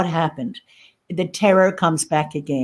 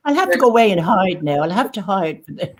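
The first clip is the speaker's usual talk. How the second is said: usual speaking voice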